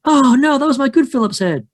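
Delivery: hammed-up creature whine of loss; skunk ape dismay. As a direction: cry out as if your favourite tool just sank. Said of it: "I'm like".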